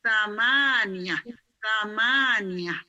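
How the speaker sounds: "Samaniyah" slowly, with a stretched middle "ma"; long MA. Shown as a demonstration. Teacher repeats for the class, long MA in "Samaniyah". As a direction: hold the "ma" in each "Samaniyah". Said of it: "mimnya panjang".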